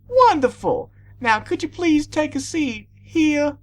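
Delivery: very high, gushing greeting; delighted teacher. A narrator's reading. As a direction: greet an old pupil with delight. Delighted teacher directs pupil.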